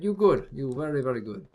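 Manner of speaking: hammed-up Polish accent praising someone. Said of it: "And I had a Polish one".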